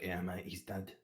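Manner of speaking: lightly voiced blunt mercenary; gruff mate. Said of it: "And Mansel's like".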